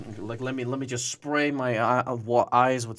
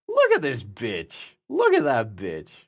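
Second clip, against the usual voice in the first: sneering; 3 semitones lower, slower than usual. Acted.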